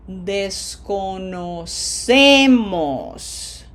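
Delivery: Desconocemos very slowly, syllable by syllable, leaning on ce; drawn-out teacher demo of Desconocemos; long vowels, penultimate stress. Shown as a demonstration. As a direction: stretch out Desconocemos with a big stressed ce. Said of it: "it is a P E two".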